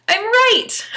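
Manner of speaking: high, loud triumphant cry; triumphant exclaim. Retold or imitated. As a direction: crow it out like a victory. Mock triumph over own belief.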